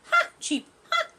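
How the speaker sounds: high chirpy ha ha; bird's mocking laugh. Bird laughing at the tortoise, chirpy ha. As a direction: cackle like a cheeky bird.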